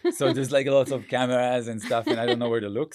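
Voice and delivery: giggly nervous admission; nervous laughing. Real, not acted. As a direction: laugh it off, a bit flustered. Guest nervous about cameras.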